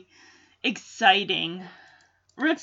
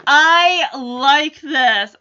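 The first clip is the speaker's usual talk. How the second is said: normal talking voice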